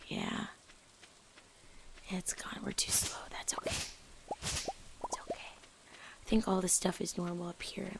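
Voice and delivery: soft unvoiced whisper. Real, not acted. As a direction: hush it to yourself. Whispers while playing quietly.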